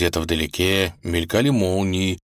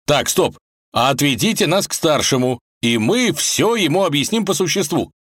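firm halt; 8 semitones higher, 4 dB louder than usual. Acted. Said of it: "миролюбиво предложил он".

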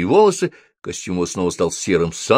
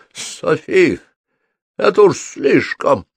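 high, strained protest with a touch of croak; hoarse croak; 10 semitones higher, 4 dB louder, slower than usual. A narrator's reading. Soaked wizard bursts in complaining to Sophie, hoarse. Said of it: "прохрипел он".

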